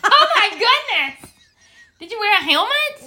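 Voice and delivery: shocked. Real, not acted. Shocked reaction to danger.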